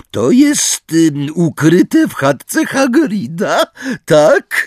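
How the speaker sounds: high, loud, drawn-out Umbridge figure; Umbridge voice, eager questioning. Acted. Umbridge questions Hermione about the hidden weapon, eager high voice. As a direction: pitch it up and probe eagerly.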